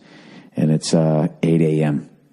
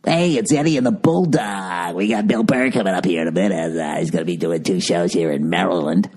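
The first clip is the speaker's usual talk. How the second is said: morning DJ voice. Retold or imitated. Comic mocking radio host announcing his shows, bad morning DJ voice. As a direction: badly imitate a morning-show host plugging a guest. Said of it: "With your fucking morning D J voice".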